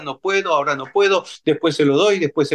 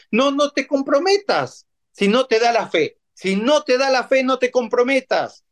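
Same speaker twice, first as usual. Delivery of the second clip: higher, emphatic preaching appeal; pitched-up pulpit urging; 8 semitones higher than usual. Acted. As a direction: drive the warning home from the pulpit.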